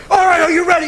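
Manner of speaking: pumped-up coach yelling; halftime pep speech. Retold or imitated. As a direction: coach firing up the team. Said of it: "He was like".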